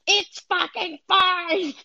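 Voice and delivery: high, stretched-out comic voice; reassuring spirit voice. Acted. Spirit to a panicking body, drawn-out comic reassurance. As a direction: draw it out high, mock-blithe. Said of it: "your spirit's like".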